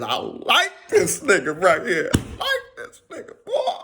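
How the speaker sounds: laughing and squealing way up high; laughing approval. Retold or imitated. Praising the man who answered, laughing high. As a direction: gush with laughter as the words go up.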